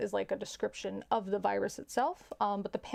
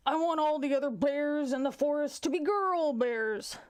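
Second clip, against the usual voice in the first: pushy joke bear; 5 semitones higher than usual. Acted. Bear wishing to be king, big pushy voice. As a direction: play a bear greedily barging in first. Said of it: "The bear says".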